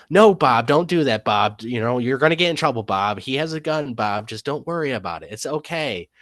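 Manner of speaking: high-pitched nagging wife, loud start easing off; imitated pleading wife. Wife warning Bob off a man with a gun, high imitated pleading. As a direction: play a bickering wife talking her husband down. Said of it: "his wife was like".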